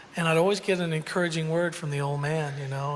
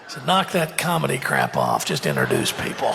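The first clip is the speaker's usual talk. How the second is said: gruff dad critique; 2 semitones lower than usual. Retold or imitated. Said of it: "And he goes".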